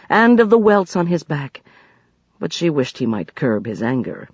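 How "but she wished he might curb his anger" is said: usual speaking voice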